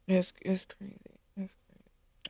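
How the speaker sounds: barely audible, voiceless whisper; overcome, whisper it as the voice gives out; tearful whisper. Real, not acted. Wife overcome thanking her husband for his sacrifices, tearful whisper.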